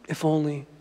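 soft, sighing mock longing; wistful regret. Preacher voicing a regretful spouse, hushed wistfulness. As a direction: sigh it out softly, as if privately wishing.

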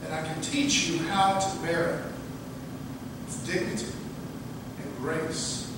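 soft and tender, fading to a whisper; gentle mouse. Acted. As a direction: comfort a hurting friend quietly. Mouse gently comforts the lion.

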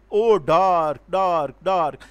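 "Oh, dark, dark, dark" loud, every word hit hard; loud, drawn-out stress on each word of "Oh, dark, dark, dark"; all syllables stressed. Shown as a demonstration. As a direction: pound out "Oh, dark, dark, dark" as equal heavy beats. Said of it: "The example of say spondy is".

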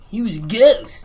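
high, loud mock announcement; mock exclaim. Ghost hunter explaining to the police, loud mock exclaim. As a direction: blurt it out as if it's obvious. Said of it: "be like".

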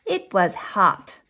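plain reading voice

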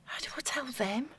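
breathy whisper, almost no voice; despairing whisper. Acted. Worried woman asks her ex how to tell her parents, whispering. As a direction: barely breathe the question out.

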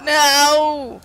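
drawn-out, high, loud "no"; frustrated cry. Real, not acted. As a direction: cry out in defeat at the game. Falls in lava while playing.

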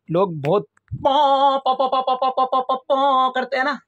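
high, slow पॉ पॉ imitating a horn; mock horn honks. Vlogger mimicking the horns people blow at the fair, high and slow. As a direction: honk out पॉ पॉ like a toy horn.

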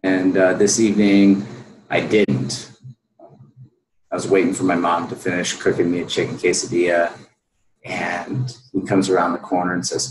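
ordinary talk

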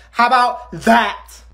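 high, loud, slow gloating yell; taunting shout. Acted. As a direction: drag it out to taunt. Teacher threatening a test.

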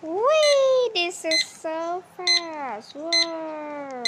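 high, loud whee sliding into a drawn-out gasp of delight; thrilled squeal. Real, not acted. Kid riding the fast elevator, squealing with excitement.

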